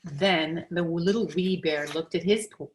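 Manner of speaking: usual speaking voice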